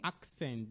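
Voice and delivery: "Accent" said with a K, AK-sent; K sound. Shown as a demonstration. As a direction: put a K before the S in "Accent". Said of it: "with a K sound there".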